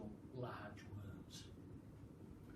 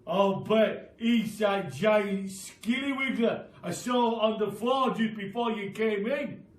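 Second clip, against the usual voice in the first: loud put-on voice teasing his wife; sly Mister Twit; 7 semitones higher, 11 dB louder than usual. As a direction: spin the scary tale with relish. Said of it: "Mister Twit said".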